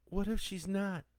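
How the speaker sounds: put-on high child's voice, close to cracking; a daughter's worried, high-pitched question; voice starting to crack. A narrator's reading. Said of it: "Lee's voice started to crack".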